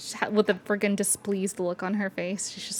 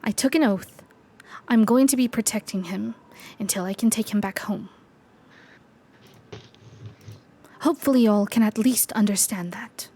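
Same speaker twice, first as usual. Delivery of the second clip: whispering. Acted. Knight character speaks in whisper.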